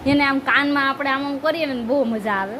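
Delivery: normal talking voice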